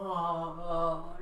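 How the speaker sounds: big stretching yawn; yawning dog. Shown as a demonstration. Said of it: "can you be a nice yawning dog".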